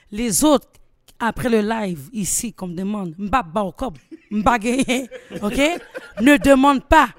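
shouting, building to laughter; fired-up rant at her chat, half laughing; loud rant. Real, not acted. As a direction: lay down the law to the viewers, loudly.

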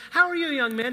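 a cheery judge saying hello; chipper mock greeting. Judge greeting the teen a second time, chipper. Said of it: "She goes".

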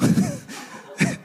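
short bitter unvoiced laugh; a sour breathy laugh at the joke. Lady at churro stand laughs bitterly at him.

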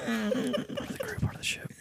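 high then whispered chant; whispering. Acted. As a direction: float the line then whisper. Catchphrase after laugh.

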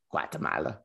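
Guatemala in a put-on Spanish accent. Host naming his friend's country, Guatemala, in a Spanish accent. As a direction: say "Guatemala" with Spanish vowels and rhythm. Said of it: "Those interesting accent".

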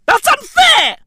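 high, loud protest; outraged cry of unfair; shouted. Shown as a demonstration. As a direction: yell it out.

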